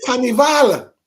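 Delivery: "Carnival" sung out high and loud, Caribbean style, dropping the R; "Carnival" in a Caribbean lilt. Shown as a demonstration. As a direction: call out "Carnival" like a calypso singer. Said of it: "So I says".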